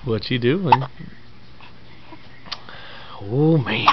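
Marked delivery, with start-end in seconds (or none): none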